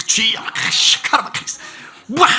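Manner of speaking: loud, high, hammed-up fake tongues; mock speaking in tongues. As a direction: put on a showy prayer babble. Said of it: "방언까지 섞어". (과장되게 흉내 낸 방언 기도)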